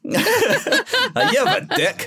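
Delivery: laughing, high mock-Scottish jab; hammed-up Scottish insult through a laugh; teasing co-host in a fake Scottish accent. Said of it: "insulting me in Scottish".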